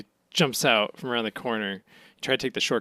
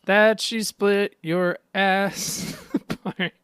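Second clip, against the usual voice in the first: swooning fan; 4 semitones higher, slower than usual. Pretend. Swooning over Buggy.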